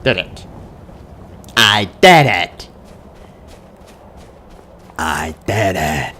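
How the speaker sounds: ordinary delivery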